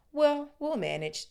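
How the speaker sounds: lightly voiced anxious mum; mildly worried mother. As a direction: reassure the kids though uneasy. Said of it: "but she looked worried".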